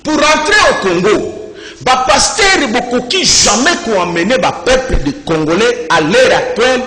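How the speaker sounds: emphatic, declamatory delivery with weighty pauses; forceful preaching. Real, not acted. Preacher rebuking pastors over celebrations in Egypt, forceful preaching.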